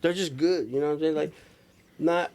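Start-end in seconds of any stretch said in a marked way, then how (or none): none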